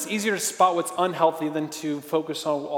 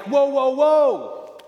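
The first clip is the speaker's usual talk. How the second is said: drawn-out high whoa, hold on there; slow warning whoa; 7 semitones higher, 5 dB louder, much slower than usual. Acted. Friend stopping you before you go wrong, slow high warning. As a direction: rein someone in, slow and raised.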